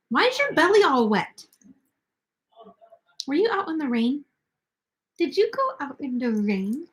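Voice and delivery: high cooing pet talk. Real, not acted. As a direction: coo gently at the dog. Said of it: "talk to their pets with, like, a baby voice".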